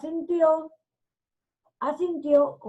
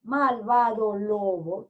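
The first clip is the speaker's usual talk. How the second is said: plain reading voice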